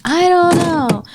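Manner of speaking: put-on high, loud, drawn-out drunk wail; imitated drunken screaming. Friend imitating a lost drunk kid, screaming. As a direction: slur it out loudly like an incoherent drunk. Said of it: "He's, like, screaming".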